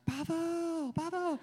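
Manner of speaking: very high squealed toddler imitation; excited toddler. Dad mimics his daughter seeing the waffles, excited toddler squeal. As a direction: squeal it like a thrilled little girl. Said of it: "She's so excited".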